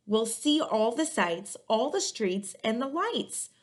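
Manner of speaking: put-on lively read-aloud to children, rhymes bouncing; story narration. A narrator's reading. Librarian reading a picture book to kids, lively and rhythmic. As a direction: storytime voice for young listeners.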